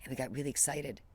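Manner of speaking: ordinary talk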